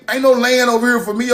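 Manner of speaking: usual speaking voice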